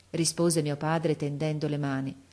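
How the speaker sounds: ordinary delivery, nothing marked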